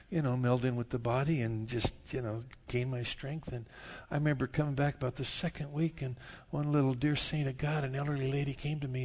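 normal talking voice

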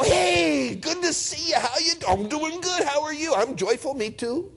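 high, gushing hello; overjoyed greeting. Acted. Friends walking in during the age of joy, overjoyed greeting. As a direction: pitch way up, bubbling over with welcome. Acts joyful future greetings.

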